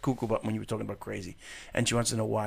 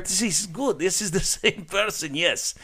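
hammed-up Russian guy accent, held for the bit. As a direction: keep the joking Russian voice going. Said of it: "that is a good accent".

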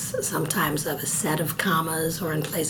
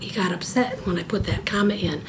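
ordinary talk, nothing marked

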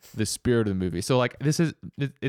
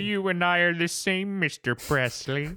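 Doctor Evil voice; 7 semitones higher than usual. Pretend. Friend jokingly recasts the movie's manager as Doctor Evil, in a mock villain voice. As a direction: purr it like a smug supervillain. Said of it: "Doctor Evil could have the big falling out".

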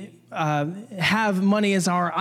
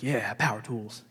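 mock men grunting; 10 semitones lower, 9 dB quieter, slower than usual. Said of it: "All the men were like".

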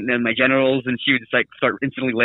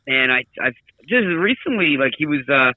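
ordinary talk

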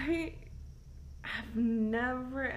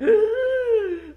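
pained gasp; 12 semitones higher, 11 dB louder than usual. Retold or imitated. Reliving the pain in the car.

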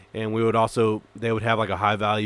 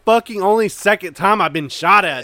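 loud, high indignant protest; exasperated outburst; 9 semitones higher, 9 dB louder than usual. Retold or imitated. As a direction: plead your case at raised volume. Acted retort to yelling leader.

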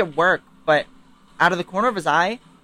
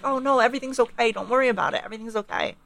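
imitating a placating mother; mock soothing; 6 semitones higher, faster than usual. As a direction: soothe him like a nervous mom. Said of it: "trying to smooth things over".

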